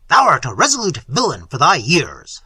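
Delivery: loud, commanding prince; forceful prince addressing a defiant youth; stern prince. Acted. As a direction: challenge the peasant forcefully. Voices Manfred, the prince.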